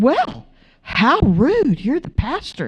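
imitating scandalized indignation; mock offended. Couple scolding their blunt pastor, mock offended.

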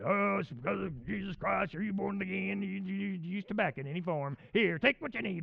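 rambling, overzealous born-again pitch; hammed-up zealot voice; pushy believer parody. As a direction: gush religion at them in one mocking rush.

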